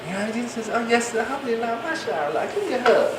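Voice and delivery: smarmy brother sweet-talking a wife; mock flirty sweet talk. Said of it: "Ain't nobody gonna be".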